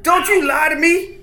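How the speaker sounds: high-pitched acted angry yell; accusing bandit shout. Bandit accusing his comrade of lying, angry shout. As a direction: snap back in mock fury. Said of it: "he's like".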